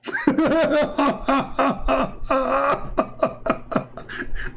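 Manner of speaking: high-pitched, sustained laughter; real giggling fit; long laugh. Real, not acted. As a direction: burst out laughing at your own story. Laughs at own story.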